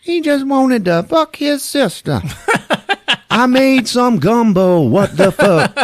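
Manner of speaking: high, slow comic lament; mock wailing maid. Arrested maid blurting out her defence, mock wailing. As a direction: wail it drawn out, laughter breaking in.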